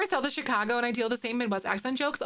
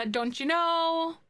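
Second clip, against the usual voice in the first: Minnesota accent; 6 semitones higher, slower than usual. Shown as a demonstration. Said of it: "I say it just all the time".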